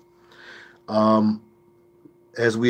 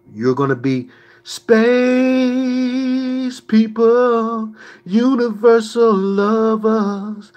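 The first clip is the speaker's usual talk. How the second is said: mocking; 10 semitones higher, 3 dB louder, much slower than usual. Mocking the universalist promise.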